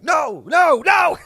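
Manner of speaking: loud, high mock-panic shouting; panicked refusal. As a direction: shout it like a traumatized kid refusing. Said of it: "P T S D".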